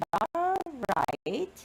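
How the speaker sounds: dry sarcastic "You're right", rising at the end. Shown as a demonstration. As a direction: say "You're right" as if you don't believe it. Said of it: "is being used in a sarcastic way".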